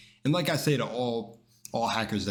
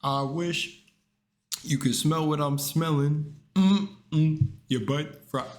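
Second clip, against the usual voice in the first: lightly mimicked meme line, a bit higher; imitating the meme guy; 6 semitones higher, 3 dB louder, slower than usual. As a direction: quote the meme like the viral guy. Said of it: "don't forget the guy".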